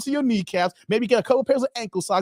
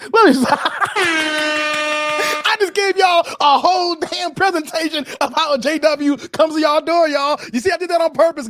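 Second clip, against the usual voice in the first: high, loud, breaking into laughter; real laughing outburst; 6 semitones higher, 5 dB louder than usual. Real, not acted. Host revealing the J W presentation, loud laughing delight. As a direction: crow it through big laughs. Cracks up after own act.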